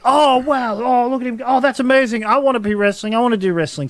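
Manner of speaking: high, gushing imitation of awed kids; hammed-up childlike excitement; excited kids. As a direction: gush like starstruck children. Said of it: "and they're like".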